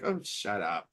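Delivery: low, quiet brush-off; dismissive guy. Retold or imitated. Rescuer brushing off the pleading dreamer, imitated low grumble. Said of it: "and he's like".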